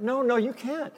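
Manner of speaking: high, pleading whine; cling to it, begging; high wailing no; whiny refusal. Retold or imitated. Piano player refuses again.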